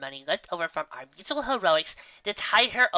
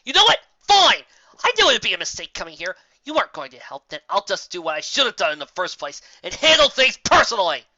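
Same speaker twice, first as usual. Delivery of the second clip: angry shout, much higher and louder; furious outburst; 4 semitones higher, 9 dB louder than usual. A narrator's reading. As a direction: explode at her, fed up. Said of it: "thoroughly incensed and completely fed up".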